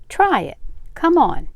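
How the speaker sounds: high, loud urging; eager coaxing. A narrator's reading. Frank coaxing the aunt onto his scooter, eager urging. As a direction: coax with a bright lift in pitch.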